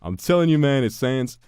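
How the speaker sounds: high, loud, insistent meme voice; imitating Uncle Aaron. Streamer quoting the Uncle Aaron meme, loud and high. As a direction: insist loudly, half joking. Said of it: "I was so happy when I saw that".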